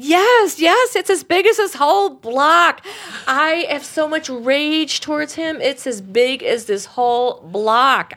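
loud, high acted outburst with stretched words; enraged outburst. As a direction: cry it out as the woman full of rage. Voices woman's huge rage.

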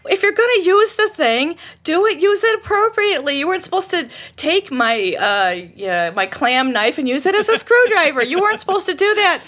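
high, loud exasperated rant; imitated husband shouting. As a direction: act out a husband scolding at full tilt. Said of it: "and he's shouting".

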